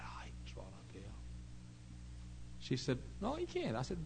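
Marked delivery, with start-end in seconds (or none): 0.0-1.1 soft breathy whisper. Retold or imitated. Preacher answering the worried mother in a whisper. 3.2-3.8 much higher, insistent; mock mother. Mother insisting to the preacher, high and insistent.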